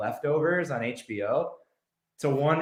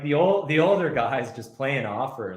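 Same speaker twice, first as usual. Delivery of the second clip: ordinary talk, nothing marked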